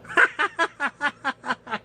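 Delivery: high, long staccato cackle; big laugh. Real, not acted. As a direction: burst out laughing at the punchline. Laughs at own punchline.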